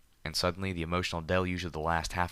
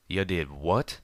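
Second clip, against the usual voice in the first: sharp, louder disbelieving snap; snapped; 4 dB louder, slower than usual. Acted. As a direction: snap the question back in shock. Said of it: "Calamity snapped".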